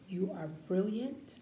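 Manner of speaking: ordinary delivery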